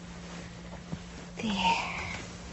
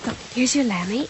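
normal talking voice

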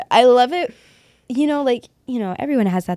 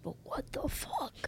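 hushed shock; 4 semitones higher, 18 dB quieter, slower than usual. Retold or imitated. Retelling a shocked reaction.

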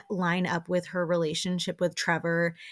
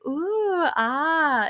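impressed ooh; 9 semitones higher, 3 dB louder than usual. Retold or imitated. Crowd reacting to outfit.

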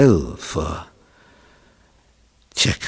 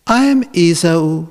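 higher, louder demonstration of the line; coached line; 8 semitones higher, 5 dB louder than usual. Acted. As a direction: say it up high, like a mother coaching. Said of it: "And you say".

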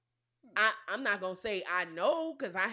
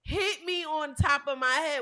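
normal talking voice

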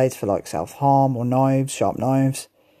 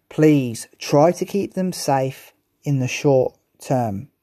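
normal talking voice

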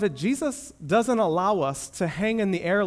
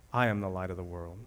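hushed reverent quote; 9 semitones lower, 7 dB quieter, faster than usual. Acted. Meditating on the quote.

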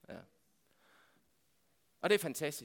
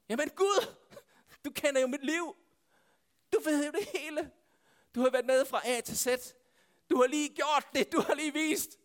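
higher-pitched, exasperated protest to God; acted protest; 8 semitones higher than usual. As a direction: plead your case, pitch lifted.

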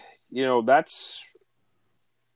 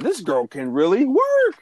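impressed exclaim; 11 semitones higher, 4 dB louder than usual. Retold or imitated. Admiring coworker's speed.

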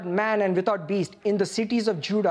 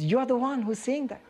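ordinary talk

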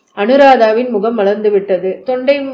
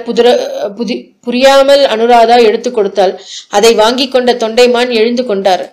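usual speaking voice

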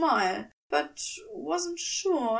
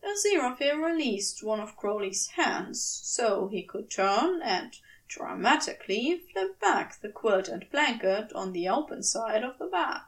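ordinary delivery, nothing marked

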